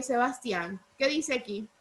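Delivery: usual speaking voice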